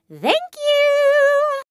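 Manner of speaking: high, held-out cutesy note; sing-song thanks. Acted. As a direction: chirp it high and hold the end.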